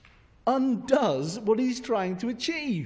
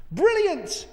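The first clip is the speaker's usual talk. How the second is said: high, loud Glaswegian exclamation; Glaswegian accent; 11 semitones higher, 6 dB louder than usual. Acted. King approves his advisor's plan, in exclaiming Glaswegian. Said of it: "why you had Glaswegian accent then".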